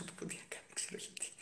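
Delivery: breathy whisper under the breath; whispered aside. Real, not acted. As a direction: drop to a whisper for "δεν ξέρω γιατί". Muttered aside after admitting ignorance. (ψιθυριστά)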